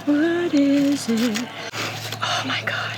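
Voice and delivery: hushed whisper, hiding a discovery. Real, not acted. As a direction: whisper so nobody hears. Finding the hidden idol secretly.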